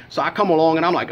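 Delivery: normal talking voice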